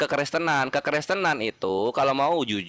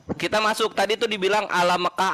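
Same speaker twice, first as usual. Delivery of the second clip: ordinary talk, nothing marked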